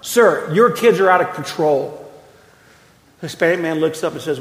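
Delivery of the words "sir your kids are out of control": indignant, raised-voice complaint, acted out; taking charge. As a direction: call out firmly to confront a careless parent. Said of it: "I'm gonna take charge".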